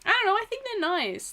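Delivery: high, gushing and sweet; excited anime fan. Real, not acted. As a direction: coo it like a delighted fan. Gushing over cute anime girls.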